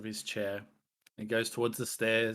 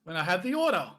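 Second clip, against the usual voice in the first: jovial minotaur boss; 8 semitones higher, 5 dB louder, faster than usual. Acted. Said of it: "He's like".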